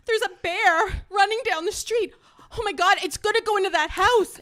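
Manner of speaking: high, loud pretend panic; mock alarm. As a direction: sell the prank with a breathless-sounding, urgent cry. Fake alarm to fool husband.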